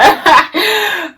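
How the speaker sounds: sudden high giggle; high burst of laughter. Real, not acted. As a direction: break into a real laugh. Laughing at friend's fake crying.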